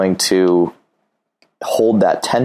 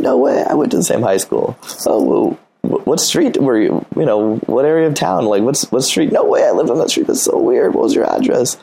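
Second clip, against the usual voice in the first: mock amazed; 6 semitones higher than usual. Acted back and forth banter.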